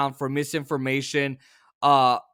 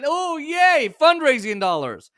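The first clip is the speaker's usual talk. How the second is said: high, cheery mock glee; mock Democrats; 8 semitones higher, 4 dB louder, slower than usual. Host mocking Democrats celebrating donations, mild mock glee. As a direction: lightly ham up delighted greed. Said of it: "And the Democrats said".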